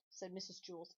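usual speaking voice

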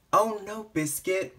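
ordinary delivery

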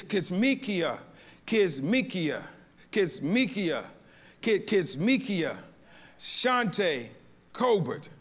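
Kismikia repeated, each one falling and deliberate; name with emphasis. Shown as a demonstration. Pastor working out the name carefully before the church. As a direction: pause between every Kismikia, then Shante, Colbert. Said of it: "I only say that name with emphasis".

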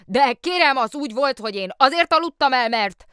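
high, loud, hurried excuses; the policeman desperately excusing himself to the mayor; flustered policeman. Acted. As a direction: stammer out an apology in a rush. Policeman tries again.

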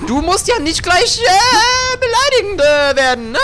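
a whiny inner voice talking back; squeaky alter ego. Acted. Arguing with himself, second voice.

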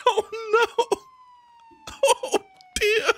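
high, laughing disbelief; laughing despair. Real, not acted. Stuck on the barrier, laughing at the mess high. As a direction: laugh high at your own disaster.

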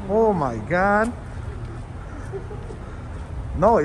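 usual speaking voice

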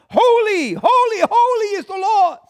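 high, loud ringing shout; angels crying out. Acted. Preacher voicing angels crying holy, high loud shout. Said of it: "Think about these angels crying out".

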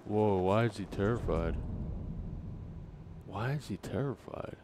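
ordinary delivery

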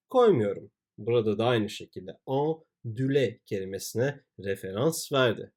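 ordinary talk

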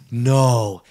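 loud, drawn-out mock disbelief; one long disbelieving "no". Customer hears of the two-hour wait, retold disbelief.

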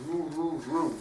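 ordinary delivery

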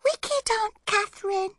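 put-on very high, wailing toddler voice; babyish, tearful complaint; sobbing small child. A narrator's reading. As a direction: whimper it like a tearful little boy. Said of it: "started sobbing and saying".

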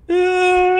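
loud, high wail of tears; mock bawling wail. Asked how she is by father figure, hammed-up wail. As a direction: burst out in an imitated bawl.

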